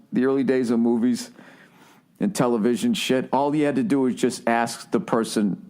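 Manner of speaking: ordinary talk, nothing marked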